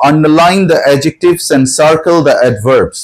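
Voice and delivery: very slow, careful classroom reading; slow dictation. Shown as a demonstration. Teacher reading the worksheet instruction to students, slowly. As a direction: spell it out slowly for the pupils.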